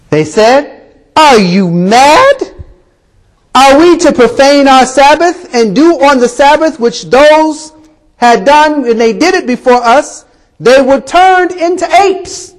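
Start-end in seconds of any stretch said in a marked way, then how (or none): 1.2-12.5 high-pitched, emphatic outraged objection; indignant protest. Acted. Tribesmen refusing to profane their Sabbath, indignant protest. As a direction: protest as if scandalized by the very idea.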